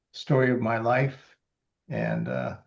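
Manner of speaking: ordinary talk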